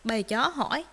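usual speaking voice

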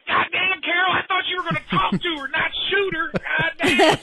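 high exasperated voice, half laughing; Tyrese. Retold or imitated. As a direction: squeal it out while cracking up. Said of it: "Tyrese went".

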